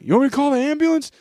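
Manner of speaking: high, fast anxious question; mock panicked girl filming; worried girl. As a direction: rush the offer in a panic, high. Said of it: "she was like".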